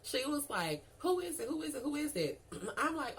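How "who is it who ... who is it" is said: high, repeated gossip-hungry prodding; mock nosy badgering; messy, nosy pestering. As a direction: imitate a coworker begging for gossip, up high. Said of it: "Queen Bean was so messy".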